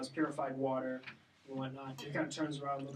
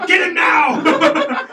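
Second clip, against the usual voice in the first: high, drawn-out yell; hammed-up shouted command; mock guard's shout; 12 semitones higher, 17 dB louder, much slower than usual. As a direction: yell the order at full volume. Game master mimics guards shouting.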